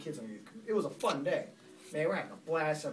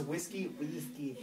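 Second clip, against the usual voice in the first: Galen; 3 semitones lower, 11 dB quieter, slower than usual. Retold or imitated. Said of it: "Galen's very hard to understand".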